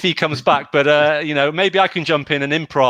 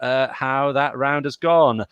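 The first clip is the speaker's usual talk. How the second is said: usual speaking voice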